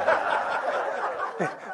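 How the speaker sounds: soft unvoiced chuckle; a short laugh at the story; quiet breathy laugh. Real, not acted. Laughs after the pulpit story.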